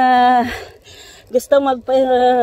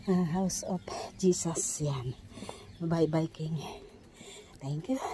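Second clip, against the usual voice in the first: whispering; 4 semitones lower, 10 dB quieter than usual. Real, not acted. Whispered aside on the road.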